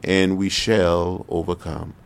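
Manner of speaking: slow, weighty "we shall overcome"; slow solemn quote. Retold or imitated. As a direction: lean on each word slowly, like a speech refrain. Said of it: "he said over and over again".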